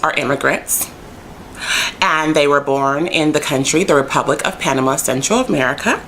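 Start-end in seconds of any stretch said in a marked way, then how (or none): none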